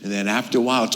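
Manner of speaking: ordinary delivery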